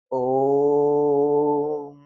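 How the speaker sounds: ओम held long and slow like a chant; drawn-out om. Shown as a demonstration. Teacher chanting ओम to show the extra-long vowel. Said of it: "देखो उच्चारण करे में कौची लगी थी?".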